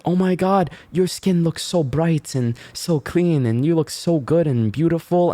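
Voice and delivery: high, fawning flattery of a customer; mock the salon lady's over-the-top praise; high sugary sales-pitch voice; gushing beautician. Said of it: "the lady was like".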